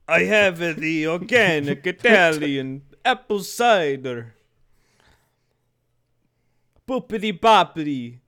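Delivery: loud, high mock French-Italian accent; hammed-up French accent sliding into bad Italian; horrible Italian accent. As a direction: put on a terrible, booming cartoon Italian accent. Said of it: "French accent that just mutated into, like, a horrible Italian accent".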